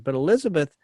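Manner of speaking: ordinary talk, nothing marked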